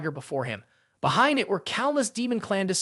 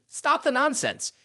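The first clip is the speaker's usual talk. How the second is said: sharp pitched-up shout; 7 semitones higher than usual. A narrator's reading. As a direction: bark the order at him. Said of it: "Xiao Zhan shouted".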